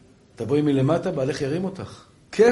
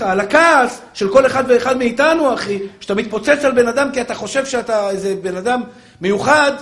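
loud, high, urgent rebuke; full-force sermon, voice raised high; fiery preaching; 10 semitones higher, 10 dB louder than usual. Acted. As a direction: thunder a warning at the congregation.

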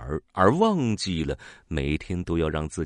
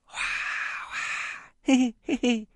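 happy bird chirp; 3 dB quieter, slower than usual. Acted. Bird happily chirping and laughing.

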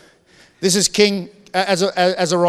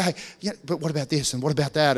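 hushed, softer sly aside; mock whisper; 5 semitones lower, 9 dB quieter than usual. Charlatan murmuring doubts to a churchgoer, hushed and sly. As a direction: lean in and murmur the doubt like a tempter. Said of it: "just whisper and they say".

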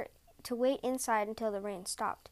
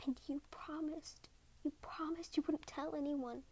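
harsh whisper; 3 semitones higher, 9 dB quieter than usual. A narrator's reading. Snow woman reproaches husband for telling, harsh whisper. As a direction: hiss the broken promise back at him. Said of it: "Yuki's voice was a harsh whisper".

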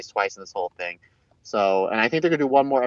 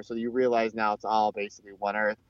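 ordinary delivery

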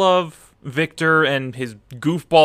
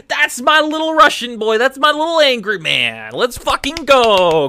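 much higher, louder fan cheer; excited cheering; 10 semitones higher, 5 dB louder than usual. Retold or imitated. Fan cheers whenever Yurio is on screen, excited. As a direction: cheer for him like a proud fan. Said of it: "I'm like".

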